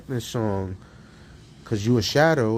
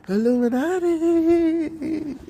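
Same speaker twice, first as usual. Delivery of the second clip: very high, slow drawn out; spooky mock; much slower than usual. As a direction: stretch it out high and eerie. Mocking rapper claim.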